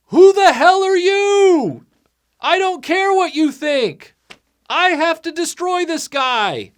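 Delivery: high, loud indignant holler; mock fighter shouting up the hill; angry yell back. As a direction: holler back at a distant meddler. Fighter yells back at the onlooker.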